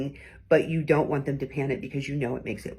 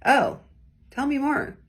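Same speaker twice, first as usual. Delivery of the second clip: mock interest, much higher and sarcastic; 9 semitones higher, 3 dB louder than usual. Shown as a demonstration. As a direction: sneer it like you couldn't care less. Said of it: "probably not gonna wanna tell me a whole lot more".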